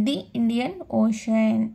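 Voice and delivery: ది said as thee, long vowel; thee before Indian. Shown as a demonstration. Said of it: "ది అని పలకాలి".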